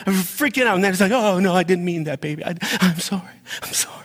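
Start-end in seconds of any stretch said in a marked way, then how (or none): 1.1-4.0 higher-pitched hurried regret, lightly acted; mock backpedaling. Speaker apologizing after being triggered, mock remorse. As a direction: imitate yourself apologizing after freaking out.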